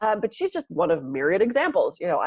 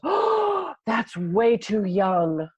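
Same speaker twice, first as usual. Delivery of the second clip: high gasp, then slow tut-tut; mock shock; 4 semitones higher, much slower than usual. Client reacting to her father's age, mock dismay. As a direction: ham up a client's shocked pity, slowly.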